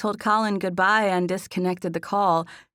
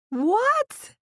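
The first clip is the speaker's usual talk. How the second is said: very high, squeaky what; high-pitched; 4 dB louder than usual. A narrator's reading. Teased friend asks what, high-pitched. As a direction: shoot the pitch right up. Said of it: "I asked in a high-pitched voice".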